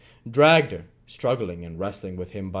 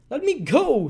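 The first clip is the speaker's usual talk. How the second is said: much higher, louder earnest plea; 8 dB louder than usual. Acted. Nancy pleading earnestly with Sikes, loud. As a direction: beg with all you have. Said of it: "with great earnestness".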